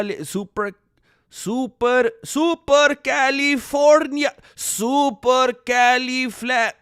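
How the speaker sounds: put-on slow, deliberate Mexican-accented sounding out; loud, slow Mexican accent, slipping toward Indian; tío's Mexican accent. Streamer imitating how tío Beto would say it, slow Mexican accent. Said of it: "it would just be really slow".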